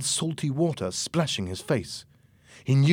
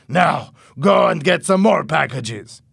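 slow, louder commanding order; bossy smuggler; 5 semitones higher, 8 dB louder, slower than usual. Acted. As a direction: order him around like a boss. Louis orders Tony.

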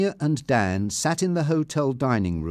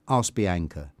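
ordinary delivery, nothing marked

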